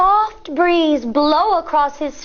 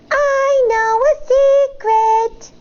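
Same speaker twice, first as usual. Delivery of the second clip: teasing singsong; 8 semitones higher, 3 dB louder, slower than usual. Acted. Sister teasing in song-like call.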